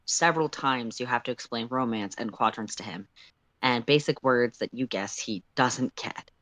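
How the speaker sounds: plain reading voice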